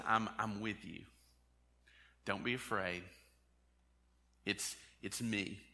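soft, low and gentle; the inner voice that calms us, soft and low; calming inner voice. Acted. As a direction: speak it quietly like a reassurance inside. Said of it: "the voice inside of us".

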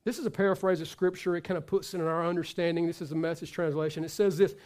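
ordinary talk

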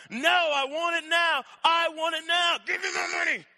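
high, loud angry shouting; acted raging demand; furious refusal. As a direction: shout down a begging debtor. Creditor refuses the plea, shouting.

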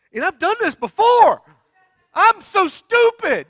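high, loud self-reproach; exasperated outburst. Retold or imitated. Man stuck in his skid steer, exasperated shouting. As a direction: yell at yourself in hammed-up frustration.

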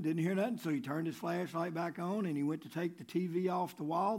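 normal talking voice

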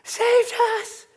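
high, loud, slow half-sung marvel; Paul in wonder. Acted. Paul standing in his cell crying out in wonder. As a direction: cry out in amazed joy. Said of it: "Paul's standing up in his cell. He's".

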